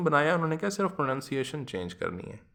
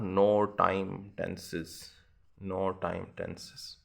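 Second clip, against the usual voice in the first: slow emphasis; 4 semitones lower, much slower than usual. Shown as a demonstration. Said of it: "teacher stressing term".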